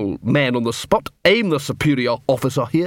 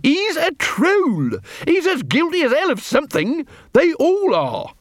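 hissed contempt. A narrator's reading. Captain leaning in to condemn the troll, hissing contempt. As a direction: press the accusation, pitch way up. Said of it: "hissed Quirk, leaning towards Carrot".

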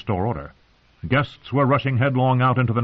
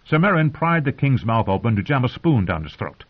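ordinary delivery

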